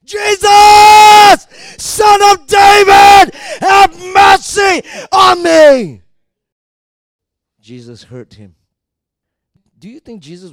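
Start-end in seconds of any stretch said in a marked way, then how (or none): 0.0-6.0 all-out, high, long-held cry; even louder shout. Acted. Blind man screaming for Jesus to hear, even louder shout. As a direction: bellow each phrase as loud as possible. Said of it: "he shouted even louder".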